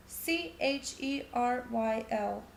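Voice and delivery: slow, letter-by-letter spelling. Acted. Child spells her name for the visitor, slow and deliberate. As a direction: spell each letter out carefully for him.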